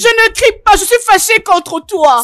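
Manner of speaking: loud, high, heated protest; angry shouting. Acted. Daughter shouting at blind mother who wandered out. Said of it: "Mais pourquoi elle crie?".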